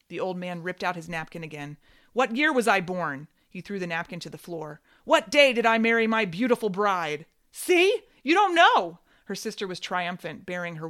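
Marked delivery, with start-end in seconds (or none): 2.1-3.3 angry father. Acted. Father angrily throws napkin. 5.0-7.3 angry father. Acted. Father rants at the children. 7.5-9.0 triumphant. Acted. Sister triumphant over father.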